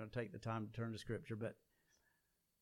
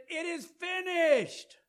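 a much higher, louder, slow ringing cry; 12 dB louder, slower than usual. Acted. Jesus on the cross crying out his last words, loud and high. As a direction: let the words burst out, drawn out and loud. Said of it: "And then he let it out and said".

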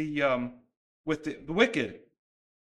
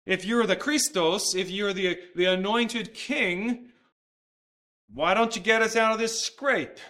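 taunting; 6 semitones higher, 5 dB louder than usual. Retold or imitated. Criminal on the cross railing at Jesus, taunting. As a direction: jeer at a supposed king in a raised voice. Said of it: "he was taunting".

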